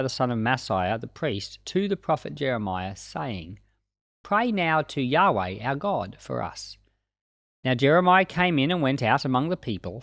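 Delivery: ordinary talk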